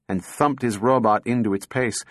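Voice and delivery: usual speaking voice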